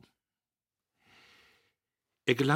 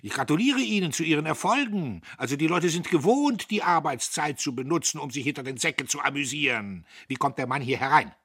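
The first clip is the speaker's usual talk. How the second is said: high, loud sarcastic tirade; scolding at full voice; loud railing; 8 semitones higher, 10 dB louder than usual. A narrator's reading. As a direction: rant at the bookkeeper in mock congratulation. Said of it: "Er zeterte".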